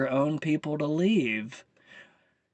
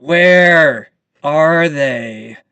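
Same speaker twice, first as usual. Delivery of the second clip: drawn-out loud demand; loud, slow demand; 9 dB louder, much slower than usual. Real, not acted. Angry vlogger demands the missing deportations. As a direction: bellow the question, slowly. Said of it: "I'm knocking over shit now".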